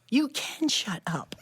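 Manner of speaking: slow, laughing mock scold; teasing quote. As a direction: drag it out through a laugh. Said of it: "And he goes".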